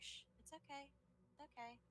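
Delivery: put-on hushed, soothing whisper to a baby; gently calm the little one; soothing shush.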